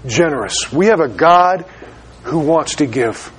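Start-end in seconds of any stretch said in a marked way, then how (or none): 0.1-0.7 Generous dropped low and soft; savoring Generous, low and hushed; soft emphatic repeat. Real, not acted. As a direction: let Generous sink in quietly. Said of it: "The word is generous here".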